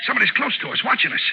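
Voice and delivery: jumpy fear of an unseen watcher; frightened alarm. Acted. Crook hears unseen laugh.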